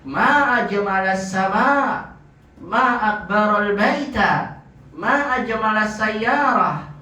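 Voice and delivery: long high MA, voice falling away at the end; exclamation intonation. Shown as a demonstration. Teacher models the exclamation of wonder for students, high exclamatory intonation. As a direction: exclaim in wonder, stretched slow, pitched high. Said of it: "kemudian intonasinya".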